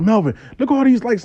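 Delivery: high, quick excited voice; retold thrilled friend; amazed excitement. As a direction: rush it out, pitch jumping up. Friend amazed by her likes.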